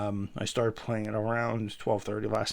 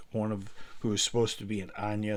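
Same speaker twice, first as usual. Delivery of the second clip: ordinary talk, nothing marked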